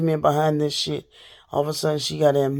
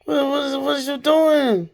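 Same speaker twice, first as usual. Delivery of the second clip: demanding to know what her cousin is doing; exasperated; 9 semitones higher than usual. Real, not acted. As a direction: stretch it out in disbelief. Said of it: "Like this".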